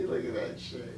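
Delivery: soft unvoiced tease; teasing whisper. Real, not acted.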